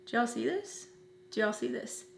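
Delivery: hushed aside to the camera; soft, deadpan check with the viewers; The Office aside. Acted. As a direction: drop your voice, confiding in the audience. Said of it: "I wanna be like The Office".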